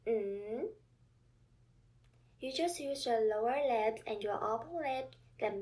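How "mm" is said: hummed "Mm" sweeping upward; second tone. Shown as a demonstration. Teacher showing learners the second intonation, rising hum. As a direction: hum "Mm" with closed lips, rising at the end. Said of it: "second Chinese intonation sounds like this".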